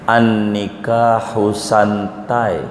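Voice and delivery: slow, deliberate wrong reading of "santai"; mock misreading. Shown as a demonstration. Teacher mocking a wrong reading for students, slow. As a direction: read "An-nikahu santai" slowly as the wrong version. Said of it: "salah baca".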